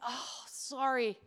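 a quiet giggle through a cheeky fib; hushed, amused little alibi; sly excuse. Retold or imitated. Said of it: "I'd be like".

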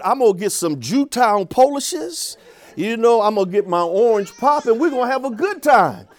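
happily announcing the food trip; great enthusiasm. Retold or imitated. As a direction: sell the treat to the students with glee. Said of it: "I said it again with great enthusiasm".

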